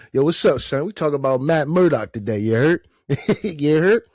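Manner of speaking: New York impression. Host redoing his intro in a light New York accent. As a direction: swagger it like a New Yorker, lightly. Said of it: "let me do my best New York impression".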